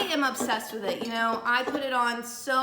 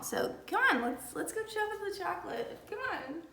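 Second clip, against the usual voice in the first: cutesy high voice; 8 semitones higher, 6 dB quieter than usual. Pretend. Inviting viewers along, put-on voice.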